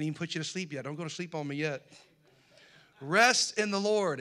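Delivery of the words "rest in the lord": loud, slow, stressing REST; emphatic preaching. Shown as a demonstration. Preacher booming the verse to the congregation, slow and loud.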